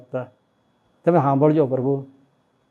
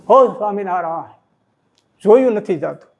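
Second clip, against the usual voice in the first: anguished cry; 4 semitones higher, 4 dB louder than usual. Retold or imitated. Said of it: "એમ ફુલાબા બોલ્યા".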